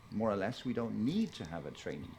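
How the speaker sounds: normal talking voice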